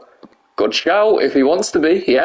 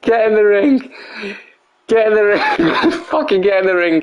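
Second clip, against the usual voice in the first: high, laughing order; exasperated command breaking into laughter; laughing exasperation; 8 semitones higher than usual. Real, not acted. Streamer ordering a wrestler into the ring, laughing and exasperated. Yelling at the game characters, laughing.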